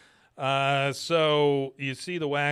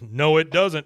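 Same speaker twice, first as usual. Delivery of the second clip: Hank snapping; 3 semitones higher, 10 dB louder, slower than usual. Retold or imitated. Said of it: "He goes".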